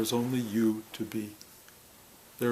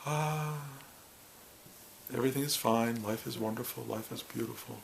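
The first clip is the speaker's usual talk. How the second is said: mock serene; 7 dB quieter than usual. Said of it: "I will sit here and say".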